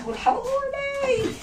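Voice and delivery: long high wailing cry for help, drawn out; screaming for help. Retold or imitated. Terrified girl calling her family, high wailing cry. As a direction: stretch it into a shrill plea. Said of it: "تصرخ وتصوت".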